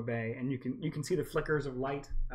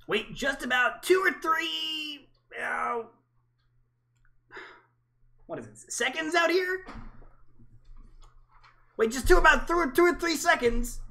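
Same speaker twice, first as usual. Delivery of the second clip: high creature voice; 13 semitones higher, 5 dB louder than usual. Acted. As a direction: shout it high like a tiny alien. GM voicing Squawks.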